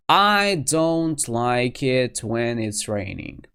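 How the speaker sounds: slow, drawn-out wording; slow over-full repetition. Shown as a demonstration. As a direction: spell out every word slowly. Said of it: "You shouldn't say".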